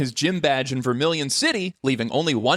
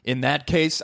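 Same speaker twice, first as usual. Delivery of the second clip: ordinary talk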